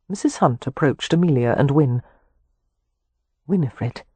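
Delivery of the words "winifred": soft and gentle, a touch quieter. A narrator's reading. Mrs Hunt gently addresses the distressed young Winifred. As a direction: say her name gently to comfort her. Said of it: "her voice was very gentle".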